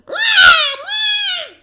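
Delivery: put-on very high loud baby cry; high screaming baby; baby wail. Baby cries.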